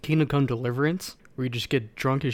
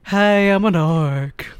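higher, louder, slow and oddly polite; polite orc voice; 6 semitones higher, 6 dB louder, slower than usual. Retold or imitated. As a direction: greet them slowly, like a harmless orc. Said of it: "This is my orc voice".

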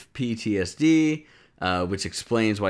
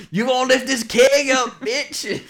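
laughing yokel taunt; country bumpkin; 7 dB louder than usual. Retold or imitated. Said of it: "some weird country bumpkin-like thing".

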